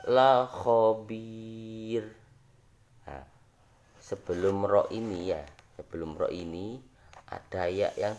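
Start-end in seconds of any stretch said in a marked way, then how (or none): 0.1-2.1 la khobir drawn out very slowly, thin ro at the end; teacher recites the example to eighth graders; Quranic recitation. Shown as a demonstration.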